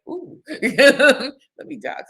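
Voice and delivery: slow, laughing, high and loud "ooh, let me duck"; laughing mock dodge. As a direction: giggle through a pretend duck. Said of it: "You'd be like".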